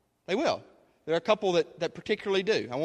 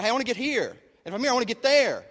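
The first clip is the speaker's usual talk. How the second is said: higher-pitched imitated striver; eager acted daydream, pitched up; mock eager ambition; 7 semitones higher, 4 dB louder, faster than usual. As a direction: act out someone keen to climb.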